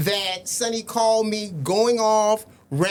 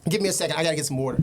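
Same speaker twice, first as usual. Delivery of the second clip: ordinary talk, nothing marked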